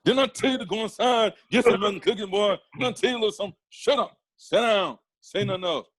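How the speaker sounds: high-pitched scolding comedian impression; Bernie Mac. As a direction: order a kid about in a put-on comic voice. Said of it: "If you sounded like Bernie Mac".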